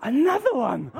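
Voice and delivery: high, hammed-up amazement; mock excitement. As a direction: exclaim it like a child given a present. Acts delight at second present.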